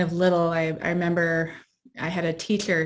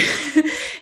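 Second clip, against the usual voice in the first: short laugh; 9 semitones higher than usual. Real, not acted. Laughs recalling sexist remarks.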